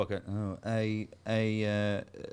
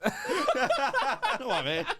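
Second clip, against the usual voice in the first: laughing; slower than usual. Real, not acted. Laughing at himself mid-story.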